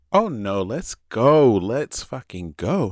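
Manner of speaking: loud, insistent imitation of a friend; feisty friend. Feisty friend urging the speaker to leave, imitated loudly and insistently. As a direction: push it out, loud and drawn out at the end. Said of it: "she is the feisty Jamaican".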